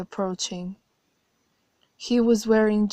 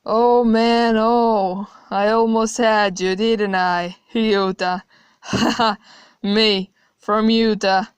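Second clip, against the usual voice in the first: loud, cackling laughter through the words; bursting out laughing; 3 semitones higher, 8 dB louder than usual. Acted. God laughing hard at the narrator he fooled, loud and mocking. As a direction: roar with laughter at your own joke. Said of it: "he bursted out laughing again, harder this time".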